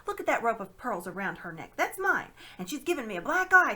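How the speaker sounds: loud, high indignant ranting; outraged. Acted. Fat man demands the constable arrest her, outraged shouting. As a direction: sputter with outrage. Shopkeeper accuses thief to constable.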